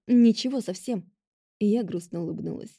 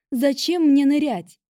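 отчаянный крик; 6 semitones higher, 6 dB louder, slower than usual. A narrator's reading. Героиня кричит в отчаянии.

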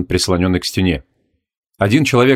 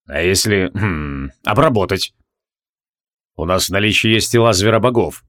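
lightly lowered voice with hesitant hums; brooding mage, pondering; 3 semitones lower than usual. Acted. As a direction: think aloud, feeling out an idea. Criol muses aloud in character.

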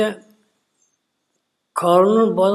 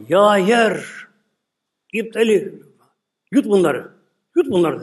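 loud, higher call to the earth; commanding shout; 5 semitones higher, 4 dB louder, slower than usual. Retold or imitated. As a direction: order the ground to swallow them. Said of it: "Musa bağırdı". (yere seslenen yüksek sesli emir)

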